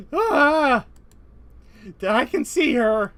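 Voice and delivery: high loud shocked outcry; shocked cry. Acted. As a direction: gasp it out, startled. Reacting to naked Miku.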